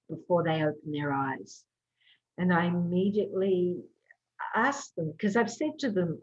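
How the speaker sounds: normal talking voice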